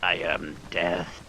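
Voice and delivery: slow, low ominous death voice; grim reaper voice. Pretend. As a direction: intone it slowly like the reaper. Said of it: "I look like death".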